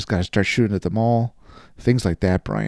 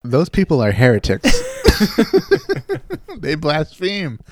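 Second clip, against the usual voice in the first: mock outrage; 7 semitones higher, 4 dB louder than usual. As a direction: denounce heretics in playful mock indignation. Mock outrage at angel toppers.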